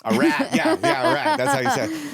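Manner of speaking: put-on high, loud joking New York accent. Guest agreeing with host's word, loud put-on New York accent. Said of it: "uh, New York snitch".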